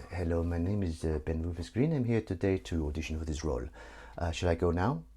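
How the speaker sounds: put-on low, subdued French-accented auditioner; softly hammed French accent. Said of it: "why is this guy French".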